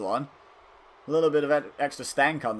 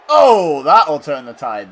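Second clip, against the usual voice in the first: excited reaction; 12 dB louder, slower than usual. Real, not acted. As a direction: react loudly to a big hit. Reacting to a big in-match hit.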